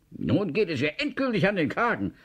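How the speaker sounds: high-pitched, gloating satisfaction; zufrieden. A narrator's reading. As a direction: crow it, sure of victory. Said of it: "sagte Herr Kurux zufrieden". (zufrieden triumphierend, in hoher Stimmlage)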